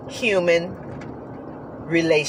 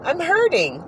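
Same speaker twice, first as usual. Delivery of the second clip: high whine; 9 semitones higher than usual. Retold or imitated. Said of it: "I'm like".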